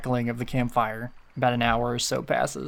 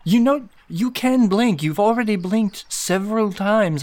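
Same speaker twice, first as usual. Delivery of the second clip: exasperated character; 8 semitones higher than usual. Acted. Eliel telling Tedward he is allowed to blink, exasperated. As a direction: stretch it out high, coaxing in exasperation.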